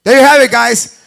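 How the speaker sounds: loud hype announcement. Real, not acted. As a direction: announce the face-off loudly. Announcing face-off.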